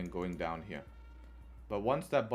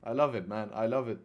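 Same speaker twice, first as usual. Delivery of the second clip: ordinary talk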